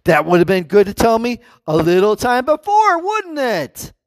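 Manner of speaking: rising, pointed movie-line complaint; a light Adam Sandler impression in The Wedding Singer; Adam Sandler quote. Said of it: "in quote Adam Sandler".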